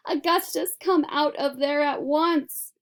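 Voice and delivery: calling out. A narrator's reading. Mother calling son in pipe.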